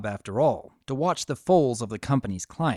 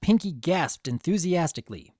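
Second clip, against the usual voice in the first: ordinary delivery, nothing marked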